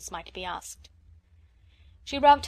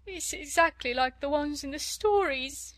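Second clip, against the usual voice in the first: higher wailing maid voice; 6 semitones higher than usual. A narrator's reading. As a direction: wail it through sobs. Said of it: "she wailed".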